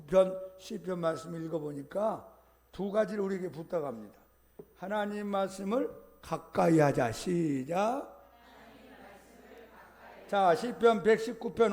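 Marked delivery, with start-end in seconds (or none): none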